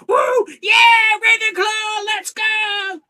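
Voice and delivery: screaming, very high house cheer; loud cheering. Acted. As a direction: scream it like a wild fan. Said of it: "starts cheering out loudly".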